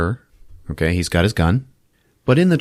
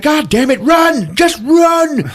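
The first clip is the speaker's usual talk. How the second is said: high, loud urgent shout; 5 dB louder, slower than usual. Retold or imitated. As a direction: yell at them to flee. Man urging escape.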